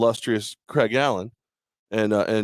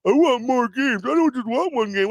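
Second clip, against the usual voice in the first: high whiny mock complaint; mock whining; 12 semitones higher than usual. Host mocking co-host's complaints, whiny mock voice. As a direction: whine it like a spoiled fan. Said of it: "pissed and moaned about".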